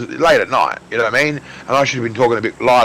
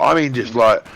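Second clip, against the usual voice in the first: ordinary delivery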